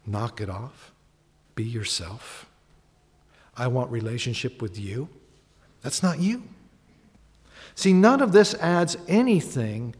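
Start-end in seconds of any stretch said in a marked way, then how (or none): 0.0-6.4 much softer and lower, tender correction; gentle God. Acted. God gently telling a praying person to be real, softly. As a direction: lovingly call them back to being real. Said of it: "God says the same thing".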